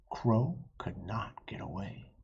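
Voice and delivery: ordinary delivery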